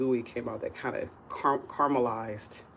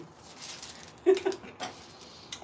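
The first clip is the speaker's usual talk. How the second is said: high giggle. Real, not acted. Laughs after apologizing to mom.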